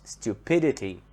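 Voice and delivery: "stupidity" stressed on PID; stress before the suffix. Shown as a demonstration. Teacher sums up the suffix rule, PID in "stupidity" stressed. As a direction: put the weight on PID: stu-PID-ity in "stupidity". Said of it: "stress here comes on the syllable that is before".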